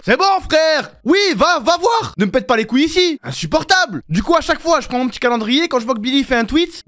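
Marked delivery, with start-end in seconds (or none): 0.1-4.0 high, loud irritated rant; exasperated telling-off. Acted. Streamer shooing intruders out of his chat, exasperated. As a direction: send them packing, fed up.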